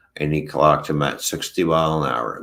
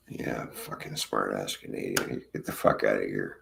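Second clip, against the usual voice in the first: low, much softer mock mutter; grumbling cop; 9 semitones lower, 9 dB quieter than usual. Cop dismissing the smart ass Canadian, low grumble. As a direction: grumble it under your breath, half laughing.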